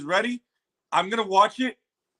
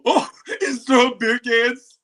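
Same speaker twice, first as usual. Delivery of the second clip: amused; 7 semitones higher, 6 dB louder than usual. Real, not acted. Laughing at trailer.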